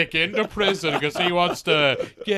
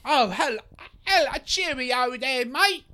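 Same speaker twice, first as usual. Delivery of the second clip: high-pitched Cockney greeting; mock chimney-sweep Cockney; 7 semitones higher than usual. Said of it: "I'm just a chimney sweep".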